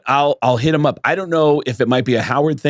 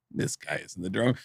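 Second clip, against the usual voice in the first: quiet, low, muttered aside to himself; doubtful inner aside; 6 semitones lower, 12 dB quieter than usual. Retold or imitated. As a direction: say it under your breath, doubting. Private doubt about stranger's claim.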